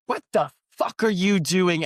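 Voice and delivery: imitating a furious boyfriend, slow and pushed; mock angry confrontation. Jealous boyfriend confronting the stranger, mock angry slow bark. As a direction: throw the question at him like a jealous boyfriend.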